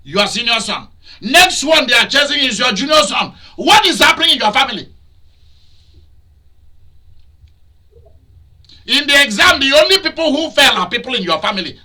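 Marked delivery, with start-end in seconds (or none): 1.2-11.8 high, loud, scolding sermon delivery; fiery preacher rebuking the congregation; preaching at full force. Acted. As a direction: thunder it at them from the pulpit.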